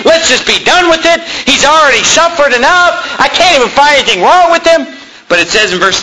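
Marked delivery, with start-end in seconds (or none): 0.1-4.9 higher, urgent pleading as Pilate; preacher playing a desperate Pilate, pleading higher. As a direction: beg the crowd to let it end, voice pitched up.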